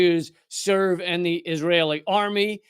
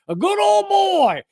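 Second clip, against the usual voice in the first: high, slow Southern twang; mock Southern accent; good old boy drawl; 13 semitones higher, 5 dB louder, slower than usual. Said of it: "talk like this".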